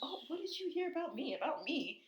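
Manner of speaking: high, sing-song fake innocence; mock innocent Candy. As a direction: play dumb in a high voice. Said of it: "And Candy's like".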